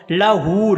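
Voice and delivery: लहू with a loud, long-held oo; lengthened haa. Shown as a demonstration. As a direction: stretch the hoo of लहू. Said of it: "हा को लंबा करना". (लहू में हू को लंबा खींचकर)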